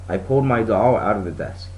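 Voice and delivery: ordinary delivery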